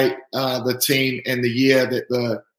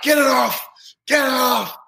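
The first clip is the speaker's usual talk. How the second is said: panicked shout; 10 semitones higher than usual. Retold or imitated. Teammates shouting at the rookie, high frantic yell. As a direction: yell it like the trophy's about to fall apart. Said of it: "And they're like".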